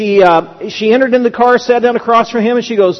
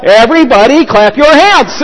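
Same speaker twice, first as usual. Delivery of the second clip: raised, projected announcer-style call; playful yell; 3 semitones higher, 4 dB louder than usual. Pretend. Preacher imagining himself in a mall, playful yell. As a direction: yell it out to a crowd, in fun. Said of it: "in the middle of the mall and yell".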